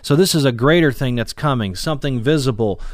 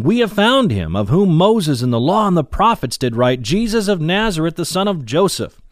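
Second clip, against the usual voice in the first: higher-pitched, excited proclamation; excited announcement; 6 semitones higher, 3 dB louder than usual. Acted. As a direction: announce the great find with lifted voice. Acting Philip announcing his find.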